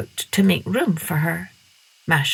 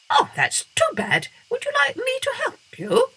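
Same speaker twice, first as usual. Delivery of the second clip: much higher, quick bat voice; squeaky bat. A narrator's reading. Bat offering to help Masha, high and quick.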